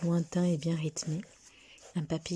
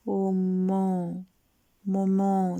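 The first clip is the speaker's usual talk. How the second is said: slow, drawn-out, choked; tearful boy; 4 dB louder, much slower than usual. A narrator's reading. Boy clinging to his mother, slow and tearful. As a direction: let it break out slowly.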